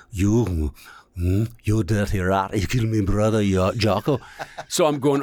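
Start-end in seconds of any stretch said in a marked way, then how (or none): 0.1-4.2 put-on lightly done gangster growl of an old movie tough guy; Jimmy Cagney impression. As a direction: toss off a quick dirty-rat gangster line. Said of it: "I don't know how Jimmy Cagney".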